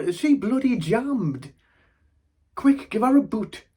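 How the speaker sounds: high, loud mock exasperation; exasperated outburst. Grandchild joking nana is stuck mid-goodbye, high loud outburst. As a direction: call out as if she's a jammed machine.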